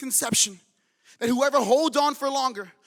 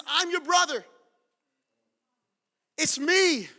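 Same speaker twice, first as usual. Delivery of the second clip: high, raised-voice reveal; impassioned cry; 6 semitones higher, 4 dB louder, slower than usual. Acted. Joseph coming clean to his brothers, impassioned cry. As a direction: cry it out to be believed.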